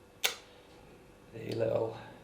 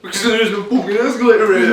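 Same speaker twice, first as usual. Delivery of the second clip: laughing; 7 semitones higher than usual. Real, not acted. Laughing at own fake trick.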